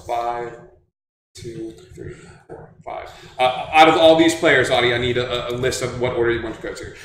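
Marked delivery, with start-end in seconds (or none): 0.1-3.1 soft, fading count into a whisper; whispered countdown. Real, not acted. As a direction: count down under the breath. Counting down quietly.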